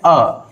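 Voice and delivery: the letter a said as a short schwa uh. Shown as a demonstration. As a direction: say a as a short uh, not ay. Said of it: "सही क्या है भाई?". (अक्षर a को छोटा अ बोलें)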